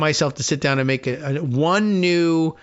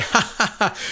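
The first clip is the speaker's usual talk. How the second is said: hammed-up fake laugh, pitched up; mock politician's chuckle; 6 semitones higher than usual. Host imagining Pierre stalling on air, mock laugh. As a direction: imitate a politician laughing off the question. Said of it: "Pierre would then say".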